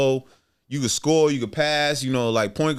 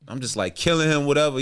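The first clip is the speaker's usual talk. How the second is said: normal talking voice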